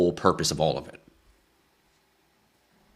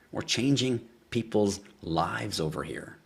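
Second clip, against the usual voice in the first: slow and drawn out; 5 dB quieter, slower than usual. Real, not acted. Host repeating his boast to viewers, slow and drawn out. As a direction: stretch every word slowly. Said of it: "repeats the line drawn out".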